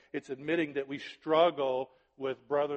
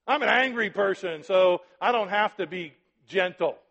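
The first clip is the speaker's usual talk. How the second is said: loud mock angry-person excuse; mock excuse-maker; 5 semitones higher, 12 dB louder than usual. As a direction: ham up the lame excuse. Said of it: "Christians in my life go".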